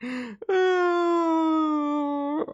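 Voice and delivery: squeaky tearful laugh; real laugh; high laughing cry. Real, not acted. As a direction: overcome with fun. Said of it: "I'm having so much fun today".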